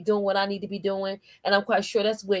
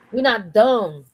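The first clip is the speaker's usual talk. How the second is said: ordinary talk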